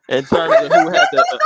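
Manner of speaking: very high, loud laugh-choked words; helpless laughter. Real, not acted. Host trying again to ask the question, helplessly laughing. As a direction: start the question and dissolve into laughter.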